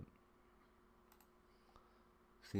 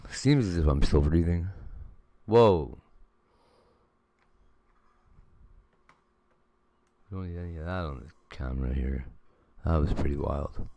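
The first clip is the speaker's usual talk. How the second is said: raised voice outdoors; loud outdoor vlog talk; loud over wind; 25 dB louder than usual. Real, not acted. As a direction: project over the noise.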